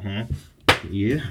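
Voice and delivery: usual speaking voice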